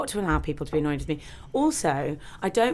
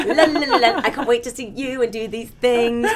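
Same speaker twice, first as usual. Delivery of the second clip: higher, louder sing-song la la la; giddy excited [name]; 5 semitones higher, 6 dB louder than usual. Acted. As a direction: play your carefree giddy self. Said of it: "[name] gets happy and she gets all excited".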